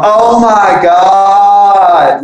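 a drawn-out, imitated gasp of alarm; mock freak-out. Classmates panicking at the door, imitated freak-out. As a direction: play the panicking classmates, slow and stretched. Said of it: "fucking freaking out".

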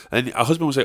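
ordinary talk, nothing marked

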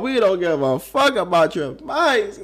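high, pushy "let's go" voice; hammed-up bored listener hurrying them; impatient listener mock.

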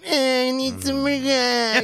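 high, slow, whining junkie voice; mocking a whiny junkie begging for gas. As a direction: drag it out like a strung-out junkie whining. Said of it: "whiny fucking".

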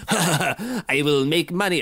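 slow, hammed-up Russian accent; badly faked Russian accent; terrible Russian accent. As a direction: put on a bad Russian accent, drawn out. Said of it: "that's a terrible Russian accent".

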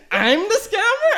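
high, loud, drawn-out mock disbelief; comically affronted squeal; incredulous. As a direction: stretch it out as an offended question. Reenacts being accused of scamming.

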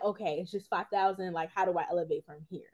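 soft, low, measured self-talk; imagined underwhelmed reaction. Retold or imitated. Interviewer imagines guest weighing next steps, mock underwhelmed. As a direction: play it down, dropping low and quiet. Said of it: "Or were you like".

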